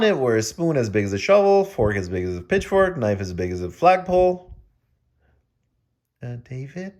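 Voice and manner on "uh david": hushed, much softer question; a soft, scared whisper. Acted. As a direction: whisper nervously to David. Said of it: "whispered Julie".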